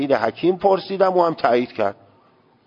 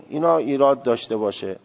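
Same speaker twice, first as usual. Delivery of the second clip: ordinary delivery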